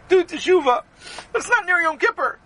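very high, tearful wail; whiny protest. Acted. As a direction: wail the objection like an upset boy. Crying Sammy protests the advice.

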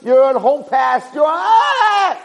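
high, squealing mock tirade; hammed-up parental meltdown; freaking-out parent. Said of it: "you freak out".

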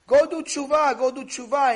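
high, loud repeated urging; urgent exhortation. Acted. Noah warning the sinners to repent, urgent exhortation.

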